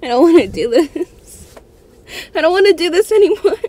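high, rushed, pleading whine; scared player begging to stop the game, high whine; frightened whine. Real, not acted.

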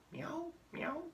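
tiny high, soft meows; very high, quiet cat mewing; cat imitation. Shown as a demonstration. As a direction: mew like a little kitten. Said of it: "what animal says".

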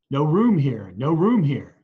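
loud, firm refusals one after another; innkeepers refusing. Acted. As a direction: bark it twice, turning them away. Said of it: "the innkeepers each replied".

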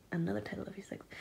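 unvoiced mock whisper. Real, not acted. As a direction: whisper it like a secret joke. Whispering the joke.

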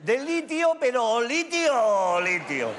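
"litio" drawn out slowly and pressed; stress on litio. Shown as a demonstration. Comedian hammering the word "litio" for laughs. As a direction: lean hard on "litio" each time. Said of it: "stretching the word litio".

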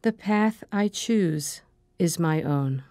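calm, measured meditation voice, short phrases with long pauses; guided meditation. Acted. Meditation leader giving affirmations to repeat after her, calm and measured. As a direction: guide them gently through breathing and affirmations, unhurried.

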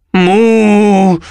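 high, drawn-out moo; protesting moo. A narrator's reading. Bessie objects to being destroyed, mooing in protest. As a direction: moo in protest like a sea cow. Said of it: "protestò Bessie".

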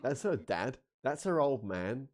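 ordinary delivery

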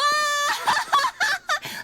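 high burst of nervous laughter. Real, not acted. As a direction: laugh it off in shock. Scared gamer bursts out laughing.

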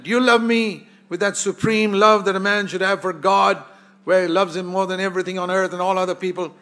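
urgent preached plea. Acted. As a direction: press the question with force. Jesus asks again, voice raised.

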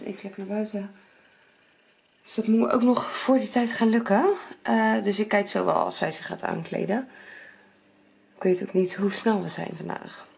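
ordinary delivery

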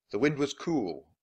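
ordinary delivery, nothing marked

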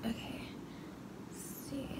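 hushed, concentrating; quiet whisper while working. Real, not acted. Whispering while cutting hair.

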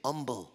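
"Humble" said as "umble", H silent; dropped H. Acted. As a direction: say "Humble" without its H, softly. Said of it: "I drop the H and just say".

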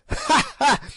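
shrill gloating witch laugh; high evil cackle. A narrator's reading. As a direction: cackle with wicked delight. Witch mocking Infadoos.

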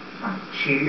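low and hushed; soft coaxing. Acted. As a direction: murmur it reassuringly. Max persuading Bloom to cook books.

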